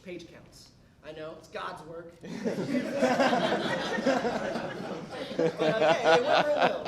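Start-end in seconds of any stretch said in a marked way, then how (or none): 2.2-5.3 loud burst of real laughter; big laugh. Real, not acted. Laughs at own joke. 5.4-6.9 words squeezed out through laughter; laughing. Real, not acted. Laughing through ironic punchline.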